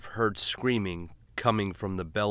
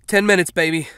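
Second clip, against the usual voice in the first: raised, strained husband's voice; tense husband; 10 semitones higher, 13 dB louder, faster than usual. Acted. Driver answering his wife in the back, strained. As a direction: hold it together while driving.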